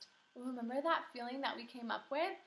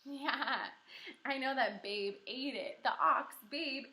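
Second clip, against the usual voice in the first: ordinary talk, nothing marked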